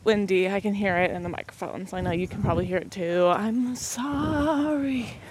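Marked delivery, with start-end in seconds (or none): 3.3-5.2 mock apology. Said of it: "Just kidding, I'm not sorry".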